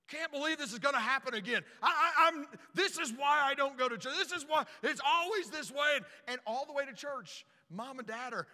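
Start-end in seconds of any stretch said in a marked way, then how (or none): 0.1-6.0 louder, higher complaining dad; an angry dad griping in the van; mad dad. Retold or imitated. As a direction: rant like a fed-up father. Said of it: "my dad is so mad".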